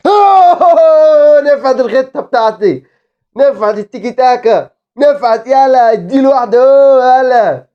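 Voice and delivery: high, loud triumphant whoop; triumphant cheer. Real, not acted. Gamer cheering his tiki-taka plan paying off. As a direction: crow over the plan working.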